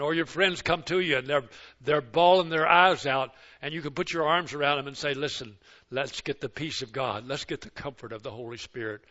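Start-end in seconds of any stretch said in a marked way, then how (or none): 5.1-9.0 soft comforting. Retold or imitated. Comforting a crying grandchild.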